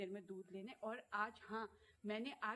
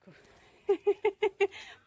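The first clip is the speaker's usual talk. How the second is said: high, loud gleeful laugh; delighted squeal laugh; 11 semitones higher, 15 dB louder than usual. Real, not acted. Laughing with delight as the dog comes, high and loud.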